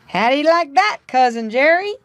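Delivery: high, teasing storybook voice; a sly friendly character voice; playful beaver voice. A narrator's reading. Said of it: "said a voice right in his ear".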